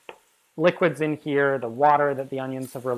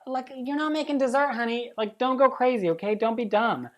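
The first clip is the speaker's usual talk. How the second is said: teasing, higher sassy warning; a light sassy telling-off to a viewer; sassy scold; 8 semitones higher than usual. Acted. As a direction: scold the viewer like a bossy friend.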